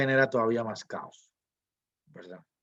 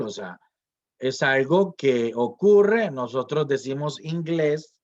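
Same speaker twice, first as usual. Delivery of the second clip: usual speaking voice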